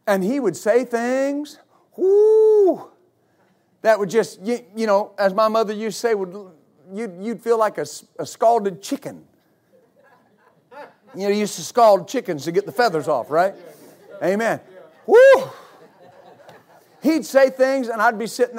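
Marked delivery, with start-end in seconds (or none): 2.0-2.9 sudden high falsetto whoop. Real, not acted. Church member recalling sermons, high falsetto whoop. Preacher's words hit hard. 15.1-15.5 loud, high whoop through laughter; laughing whoop. Real, not acted. Preacher recalling pastor's sermons, loud high laughing whoop. Laughing over scalded chicken line.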